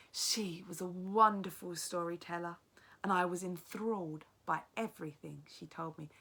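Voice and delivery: lively read-aloud narration, teacher to class; story narration. A narrator's reading. Teacher reads the novel aloud.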